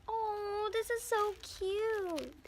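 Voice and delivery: high slow cooing squeal; excited. Real, not acted. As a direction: coo over something cute. Cute squishy reveal.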